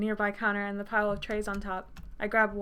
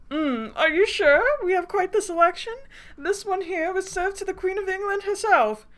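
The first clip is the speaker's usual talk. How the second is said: high, hammed-up posh waiter; posh accent; 10 semitones higher, 5 dB louder than usual. Fancy waiter pressing his selection on her, posh accent. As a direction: lift it high and grandly posh. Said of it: "I've been working on that impression".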